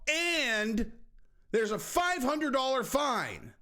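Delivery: high, slow mock announcement; mock official. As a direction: drag out the fine like a smug official. Mocking the commission's announcement.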